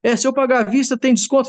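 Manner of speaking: very high, eager voiced father; a proud man asking with glee. Proud father asks manager about paying cash, very high.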